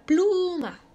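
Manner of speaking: teacher demonstrating the strong PLU in pluma; stress on PLU. Shown as a demonstration. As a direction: stretch and push the first syllable of pluma. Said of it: "ahí está la sílaba".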